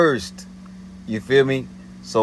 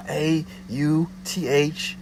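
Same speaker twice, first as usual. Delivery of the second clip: slow, higher mock-stern spelling; imitating a curt caller spelling a name; aggressive spelling; 6 semitones higher, slower than usual. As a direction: spell it out at her, slow and pointed. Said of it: "spelling it out aggressively".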